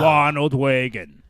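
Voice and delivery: "Ronald Reagan" slow, set in the back of the throat, American accent; slow throaty American. Shown as a demonstration. Coach shows the American r sitting back in the throat, slowly. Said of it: "Let it sit in the back of your throat".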